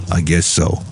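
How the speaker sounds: low comic parking-attendant voice; Valentino. Acted. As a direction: answer in a put-on deep voice. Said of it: "This is Valentino".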